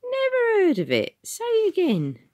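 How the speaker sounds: brushing the lad off; very high Cockney ticket man. Acted. Ticket man to Tom, high and loud.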